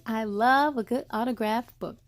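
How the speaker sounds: ordinary delivery, nothing marked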